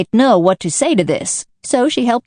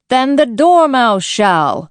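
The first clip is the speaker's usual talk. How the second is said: slow, drawn-out joint call; two voices crying out to wake the Dormouse; mild cry; 6 semitones higher, 3 dB louder, slower than usual. Acted. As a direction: call out the name together, slowly. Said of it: "they both cried".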